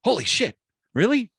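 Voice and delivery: high, loud amazed disbelief; joke-teller's incredulous outburst; astonished bar patron. Acted. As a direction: act out a stunned tourist, pitch jumping up. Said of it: "He's like".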